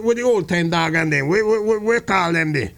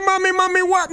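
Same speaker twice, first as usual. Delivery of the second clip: put-on boy's voice; 10 semitones higher than usual. Boy voice.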